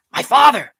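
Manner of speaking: high, loud urgent warning; urgent hiss. A narrator's reading. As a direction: warn your friends in sudden alarm. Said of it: "Juliana hissed urgently".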